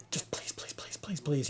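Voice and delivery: urgent whisper under the breath; hushed, breathy begging; whispered pleading. Retold or imitated. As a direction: whisper it like a desperate prayer. Silent pleading while watching take.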